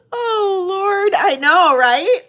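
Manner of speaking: loud laughing exclamation; cracking up mid-sentence; laughing hard. Real, not acted.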